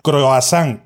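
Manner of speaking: "croissant" said as cro-a-SÁN; local mispronunciation. Retold or imitated. Speaker listing how locals say it, croissant as croasán. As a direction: open "croissant" with cro-a, ending in NG. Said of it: "hay gente que dice croissant".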